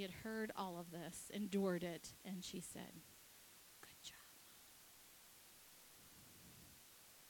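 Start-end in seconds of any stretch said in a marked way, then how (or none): 3.8-7.3 imitated near-whisper; hushed cashier's aside; quiet encouragement. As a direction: say it very softly, as a kind aside. Cashier's comment to embarrassed mother.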